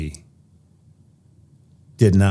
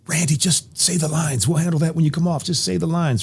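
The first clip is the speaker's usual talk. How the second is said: director; 10 semitones higher than usual. Retold or imitated. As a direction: coax the boy like a patient director. Director voiced in story.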